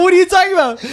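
very high, incredulous outburst; exasperated. Retold or imitated. Son to scammed mom, exasperated. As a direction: squeal it in comic disbelief.

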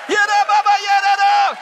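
loud mock babble; shouted gibberish. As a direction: yell nonsense syllables. Mock tongues outburst, shouted.

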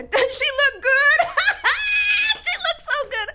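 very high, booming laughing exclamation; loud husband. Retold or imitated. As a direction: whoop it out with a big laugh. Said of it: "my husband's a loud man".

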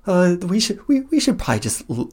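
high, quick, hesitant companion voice; a lightly voiced worried pet urging retreat; nervous Kuro. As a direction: Kuro timidly suggests they leave. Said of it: "Kuro's like".

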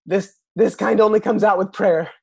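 mock frustrated exclamation; exclaim. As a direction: blurt it out loud and high in frustration. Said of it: "I just kind of exclaim".

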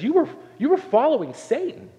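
high, pressing preacher voice; emphatic paraphrase. Acted. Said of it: "He says".

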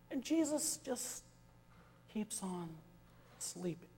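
hushed storyteller's near-whisper. A narrator's reading. Telling the sleeping Jesus, in a hush.

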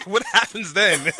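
laughing through the question. Real, not acted. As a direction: giggle around the words. Laughing at his own tease.

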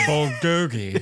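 bulgogi drawn out slowly in a mock British accent. Friend asked to say bulgogi in a British accent, mock British. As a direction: stretch bulgogi out like a Brit. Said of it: "Say that with a British accent".

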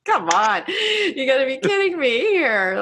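high, incredulous come on; exasperated disbelief. Retold or imitated. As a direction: scoff in disbelief. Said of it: "it's just like".

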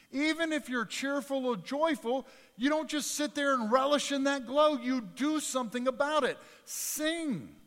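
high, loud preacher voice, pressing hard; preaching at full force. Acted. Preacher reading James to the congregation, full force. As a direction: drive the verse home to the congregation.

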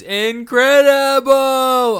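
loud, high, drawn-out rave; excited exclamation. Real, not acted. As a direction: gush about it at full volume. Excited about cask strength port.

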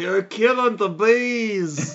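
high, very slow mock Australian accent; Steve Irwin. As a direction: draw every word out in bad Aussie. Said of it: "the worst Steve Irwin I've ever heard".

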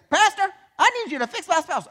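high, loud mimic of a whiny spouse; mock complaining spouse. Pastor mimicking a spouse who blames their partner. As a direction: play the spouse begging the pastor. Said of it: "point at your spouse and say".

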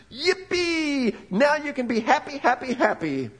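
high, hammed-up giddy cheer; mock glee. Preacher mocking shallow happiness, high and giddy. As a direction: squeal it like a cartoon fan.

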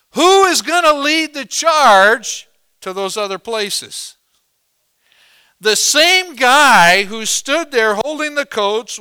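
loud, high-pitched pulpit proclamation; full-force preaching. Acted. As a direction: belt it out to the whole congregation.

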